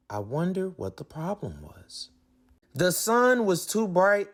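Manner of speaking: plain reading voice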